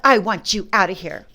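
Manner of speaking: retold demand. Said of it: "She said".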